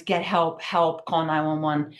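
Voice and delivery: ordinary delivery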